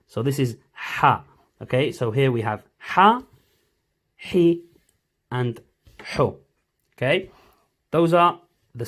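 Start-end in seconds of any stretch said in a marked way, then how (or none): 0.1-6.4 Ha with a sharp throaty H; teacher modelling the sharper Ha; sharp Ha drill. Shown as a demonstration. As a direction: push pressure into the H of Ha, Hi, Ho. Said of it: "This is the sharper one".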